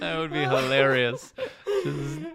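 wheezing with laughter; laughing hard mid-sentence. Real, not acted. Laughing fit.

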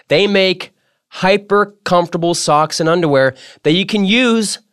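high, bright-eyed sponsor pitch with stretched selling lines; hyped ad read. Acted. Host reading the sponsor ad, high excited pitch. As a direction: sell the socks like the best news all day.

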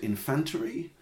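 INFANTERY with a stressed "fan" and extra syllable; mum's INFANTERY. Retold or imitated. Son quoting his Spanish mum, phonetic INFANTERY. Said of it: "she always used to pronounce it".

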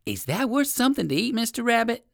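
high-pitched little dog voice; exclaiming poodle. A narrator's reading. Poodle asking the rabbit for food, exclaiming. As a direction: ask eagerly, pitched high. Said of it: "exclaimed the poodle doggy".

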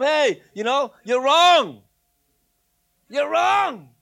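loud, high preacher's shout; shouted confrontation; blunt correction. Acted. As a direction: call them out at full volume, drawing out the last word. Acts out someone confronting church members.

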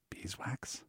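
breathy, puzzled echo of the word; incredulous whisper. A narrator's reading. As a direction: whisper the word back in disbelief. Eric repeats word, whispered.

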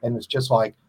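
normal talking voice

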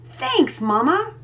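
put-on a kid amazed by a present; excited whoa; exclaiming. A narrator's reading. Boy thanks mother.

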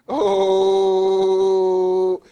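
loud, sustained, level "ohhh"; long drawn-out "oh". Real, not acted. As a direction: hold the "oh" on one note. Drawn-out exclamation before repeating warning.

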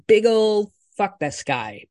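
emphatic dismissal. Real, not acted. Venting at the commenter.

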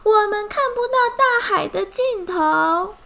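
high, loud wail of the line; 我们看不到大海的尽头 shouted high and loud, not whimpered; loud together. Acted. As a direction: cry it out loudly at the fish. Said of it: "一起大声说".

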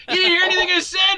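high, fast, outraged yell; mock-outraged cry of a hurt friend; indignant shout. Partner indignant he wasn't listening.

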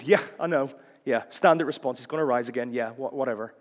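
going through the motions, trailing away; dismissive platitude response. Acted. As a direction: brush it off like a stock answer. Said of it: "or in response to a platitude".

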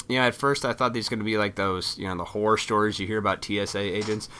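ordinary talk

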